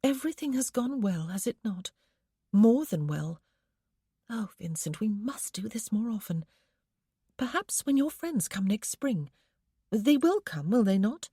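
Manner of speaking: hint of a contented lady in love; lightly voiced young wife. A narrator's reading. Sophia voiced by narrator.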